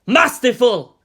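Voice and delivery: loud, high exclamation; gleeful shout. A narrator's reading. Premier slamming the desk, loud and triumphant.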